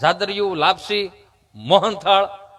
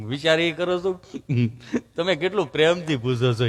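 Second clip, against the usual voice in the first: imitated halting stammer, pitched down; stammering friend; 4 semitones lower, 4 dB quieter than usual. Friend telling comedian he's going for vaccine, imitated stammer. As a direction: play the friend whose tongue sticks. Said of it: "જીભ જલાઈ ચોંટે અટકે".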